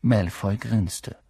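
ordinary delivery, nothing marked

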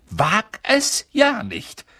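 a mother snapping at her son; stern warning. Acted. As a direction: stretch each word out as a threat.

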